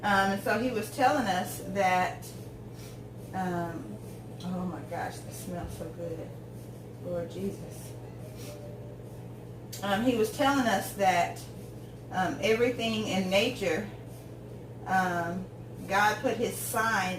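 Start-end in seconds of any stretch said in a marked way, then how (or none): none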